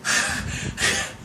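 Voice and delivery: unvoiced nostril snort like a dragon. Shown as a demonstration. Preacher imitating God's angry snort. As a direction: blow hot air through the nose. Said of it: "콧김을".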